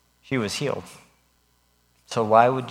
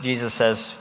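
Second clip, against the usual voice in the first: normal talking voice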